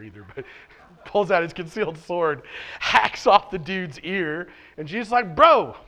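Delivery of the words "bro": mock exclamation. Jesus scolding Peter in story.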